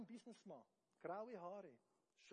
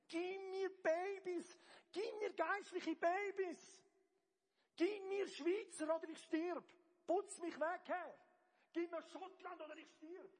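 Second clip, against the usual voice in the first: loud, high, slow desperate prayer; desperate cry; 6 semitones higher, 16 dB louder, slower than usual. Shown as a demonstration. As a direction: cry out to God as if dying. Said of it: "Brüll, wie fast verzweifelt". (laut, hoch und verzweifelt gebetet)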